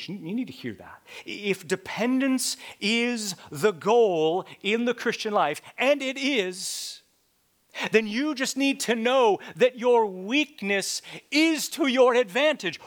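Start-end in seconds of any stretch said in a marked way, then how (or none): 1.3-12.8 louder, higher preaching on "your weakness is to your advantage"; loud repeat. Shown as a demonstration. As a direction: proclaim it the second time. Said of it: "I'm gonna say that again".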